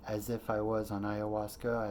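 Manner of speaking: ordinary talk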